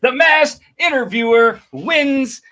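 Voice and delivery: slow, high announcer call; game-show host declaring victory; winner announcement. Real, not acted. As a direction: proclaim the winner, spacing out each word.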